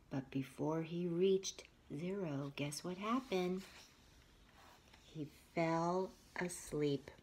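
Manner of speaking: soft whisper building suspense; hushed suspense. Acted. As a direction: whisper it like a bedtime secret. Suspense before falling asleep.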